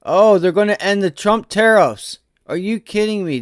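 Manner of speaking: shocked exclamation at the headline; stunned outburst. Real, not acted. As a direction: blurt it out as the news hits. Reacting live to breaking news.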